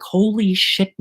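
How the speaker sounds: high-pitched retold alarm. Kid hears voices outside, alarmed. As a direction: act out a sudden jolt of alarm. Retelling his frightened reaction.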